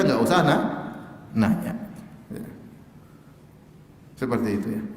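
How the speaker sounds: normal talking voice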